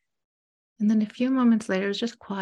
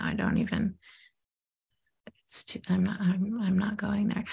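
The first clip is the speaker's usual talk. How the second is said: hushed, faltering; 4 dB quieter than usual. Retold or imitated. Client shrinking away from her anger, whispering. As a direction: voice sinks to a whisper, breaking off. Client retreating from painful feelings.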